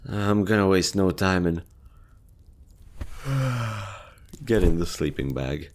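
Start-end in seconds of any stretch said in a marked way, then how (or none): 3.0-3.9 tired sigh. Acted. Settling into the sleeping bag.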